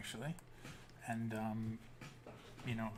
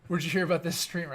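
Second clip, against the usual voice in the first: quick, higher, louder question; curious coworker; 6 semitones higher, 8 dB louder, faster than usual. Retold or imitated. Coworker asking where he heard of the stream, quick and keen. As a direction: ask fast with sudden interest. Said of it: "he goes, he goes".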